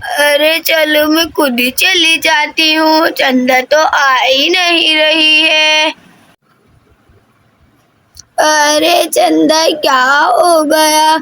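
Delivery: slow, drawn-out grandmother voice; old grandmother. Acted. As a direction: stretch the words like an unhurried old grandmother. Grandmother figure in story. (धीमी, खिंची हुई दादी की आवाज़)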